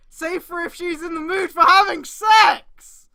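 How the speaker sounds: loud, slow, disbelieving shout; outraged shout. Real, not acted. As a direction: shout it out in shock. Reading absurd fanfic line aloud.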